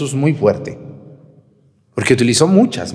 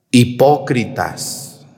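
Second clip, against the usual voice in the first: drawn-out, emphatic accusation; slow rebuke; much slower than usual. Acted. Priest voicing Jesus calling out hypocrites, drawn-out. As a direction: stretch the word out as an accusation. Quoting Jesus's harsh words.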